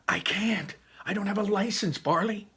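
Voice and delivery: high-pitched, worried pleading; panicked protest. Acted. Ian without a license to his brother, panicked.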